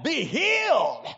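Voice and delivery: high, slow, forceful faith-healer cry; Ernest Angley. Retold or imitated. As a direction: climactic mock TV healer command. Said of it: "and he would go".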